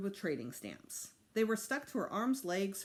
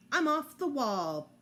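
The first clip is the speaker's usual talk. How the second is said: louder, higher proud chant; proud repeat; 7 semitones higher, 7 dB louder, slower than usual. A narrator's reading. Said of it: "Fudge repeated".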